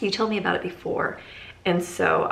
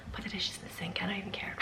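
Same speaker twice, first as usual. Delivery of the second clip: hushed giving up; 10 dB quieter, faster than usual. Retold or imitated. Tired mom quoting herself, dishes.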